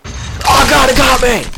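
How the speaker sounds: high, loud frightened scream; panicked scream. Real, not acted. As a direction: shriek as it grabs you. Caught by monster in game.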